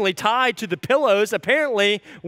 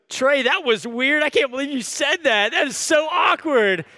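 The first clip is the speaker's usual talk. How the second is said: high-pitched mock-appalled wife; imitating a wife's blunt ribbing; playful teasing; 6 semitones higher than usual. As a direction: tease him publicly, pitched up and emphatic. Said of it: "trying to be playful and fun".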